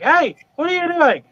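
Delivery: high, playful "gotcha" call; spring it on them high and bright; mock surprise call. Dad bursting in on the hiding kids, high playful call.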